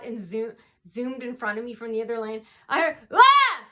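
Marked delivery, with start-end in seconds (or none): 3.1-3.6 high, loud wordless mock shout; imitated yell. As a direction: blare out one short mimicked yell. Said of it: "Like that".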